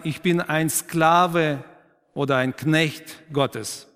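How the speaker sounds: slow, each word weighed, stress on „bin“ and „Knecht“; slow weighty emphasis. Shown as a demonstration. Preacher to congregation, showing the emphasis slowly. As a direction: lean on „bin“, then land hard on „Knecht“. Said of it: "Welche Betonung entsteht".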